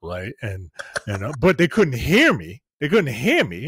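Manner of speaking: normal talking voice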